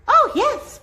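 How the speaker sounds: very high, small and chirpy; high little piping yes; piping tiny voice. A narrator's reading. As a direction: pipe it up like a tiny mayor. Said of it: "piped the voice".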